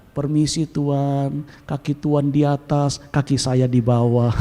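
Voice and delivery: slow, low, exaggeratedly polite Solo Javanese; lemah lembut. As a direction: draw it out meekly, low and deferential. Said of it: "lemah lembut". (lemah lembut, pelan dan rendah ala orang Solo)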